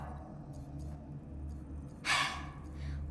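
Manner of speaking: long, soft tired exhale; weary sigh. Acted. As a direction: sigh before giving in. Salarian hears orders to stay put.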